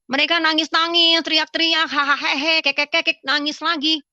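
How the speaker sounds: high, loud mock hysteria; mocking imitation. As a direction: mimic a wailing, cackling crowd. Mimicking frenzied worshippers she found weird.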